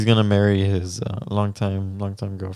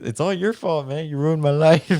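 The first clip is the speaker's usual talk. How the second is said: mock angry; 7 semitones higher than usual. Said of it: "Benito got mad at him".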